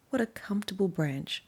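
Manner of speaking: plain reading voice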